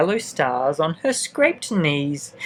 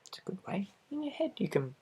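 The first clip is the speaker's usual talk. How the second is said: soft breathy whisper aside; 4 semitones higher, 15 dB quieter, faster than usual. Acted. Reader to child, a secret whispered aside. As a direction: lean in and whisper it.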